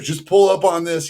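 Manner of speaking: egging him on to pull; coaching push. Retold or imitated. Trainer quoted at the gym.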